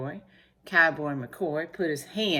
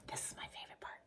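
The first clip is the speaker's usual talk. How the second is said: voiceless, very soft whisper; hushed little aside; 20 dB quieter, much faster than usual. Real, not acted. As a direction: whisper it confidingly to the listener. Private aside while reading aloud.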